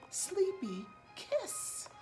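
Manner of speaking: ordinary delivery, nothing marked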